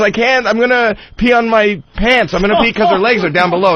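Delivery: put-on high, fast little-girl protest; whiny toddler. Daughter protests to dad she'll pee on her pants, high and whiny. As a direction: whine like a panicky small child.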